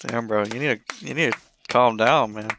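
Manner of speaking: usual speaking voice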